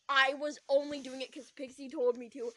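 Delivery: loud, emphatic excuse; defensive protest. Acted. Ruth explaining creeping around, defensive protest. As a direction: protest it loudly, shifting the blame.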